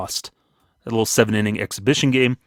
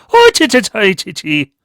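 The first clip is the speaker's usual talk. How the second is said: chanting cheer; 10 semitones higher, 7 dB louder than usual. Pretend. Irish cheer after intro.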